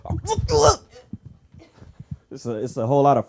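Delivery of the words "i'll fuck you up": very high, strained threat; mock tense threat. Comic shows a tense shaking threat, strained high mock fury. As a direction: grit it out shaking.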